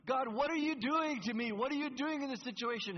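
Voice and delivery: loud, high, demanding cry of God, what are you doing to me; demanding shout at God; accusing cry to God. Shown as a demonstration. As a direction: hurl the question at God in protest. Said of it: "Do you see the difference?".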